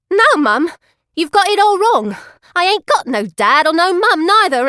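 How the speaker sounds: high, loud pleading cry; upset outburst. A narrator's reading. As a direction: burst out, close to tears. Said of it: "Something happens in me head, and I says".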